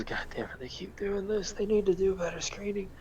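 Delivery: sighing, muttered under his breath, half whispered; exasperated mutter. Acted. Tired guard mutters about bad screening, half whispered. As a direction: grumble it to yourself like a fed-up guard. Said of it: "sigh and rub his".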